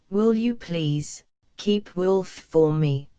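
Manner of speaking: ordinary delivery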